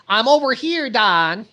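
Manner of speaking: calling out loud to a car across the driveway; high, loud hail across the street. Acted. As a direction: raise the voice to catch a friend's attention.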